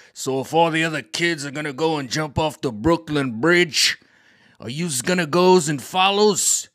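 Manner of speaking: lecturing dad. Retold or imitated. Mechanic dad lecturing son.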